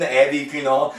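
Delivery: ordinary talk, nothing marked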